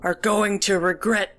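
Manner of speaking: mildly wheezy, deliberate menace; wheezed threat. A narrator's reading. Panting grandpa to the girl, wheezing threat. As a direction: threaten while out of breath, breaking off. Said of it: "he wheezed".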